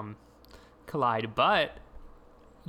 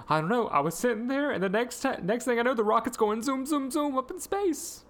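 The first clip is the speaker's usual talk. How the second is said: much higher, playful Raven voice; voicing Raven; 10 semitones higher than usual. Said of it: "She goes".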